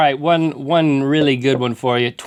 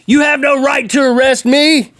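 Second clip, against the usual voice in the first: high-pitched mock indignant yell; screaming; 10 semitones higher, 4 dB louder than usual. Arrested Frosty screaming at the police, mock indignant yell. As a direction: protest in a hammed-up raised voice. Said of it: "He was screaming".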